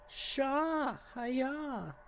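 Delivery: imitating the game character's anime voice; anime girl voice. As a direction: parrot her cutesy greeting. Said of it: "Why does she sound like an anime character?".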